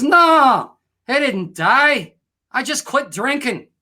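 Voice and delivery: high, loud Irish protest; punchline in a put-on Irish brogue; Irish accent. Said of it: "if you did that in an Irish accent".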